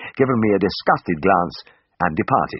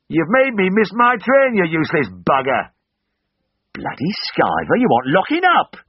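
angry abuse; 11 semitones higher than usual. A narrator's reading. Waiting airmen abusing the man throwing their laundry, angry high yells. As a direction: hurl insults furiously across a crowd. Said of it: "turned from eager to angry".

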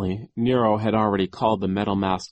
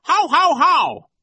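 high, loud "how, how, how" laugh; fake villain laugh; 9 dB louder, slower than usual. A narrator's reading. Count Olaf laughs along in disguise, loud spoken laugh. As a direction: bellow out a high, mirthless haw-haw. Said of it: "abruptly stopped laughing".

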